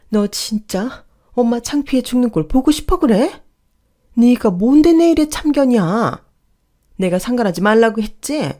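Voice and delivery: high, loud outraged scolding; angry yelling. Acted. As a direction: yell at the meddling son, peaking loudest midway. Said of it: "똑같이 소리 지르며". (높은 목소리로 소리 지르는 호통)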